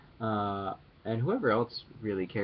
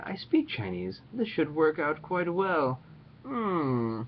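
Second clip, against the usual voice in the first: scheming; 6 semitones higher, much slower than usual. Retold or imitated. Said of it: "And I'm like".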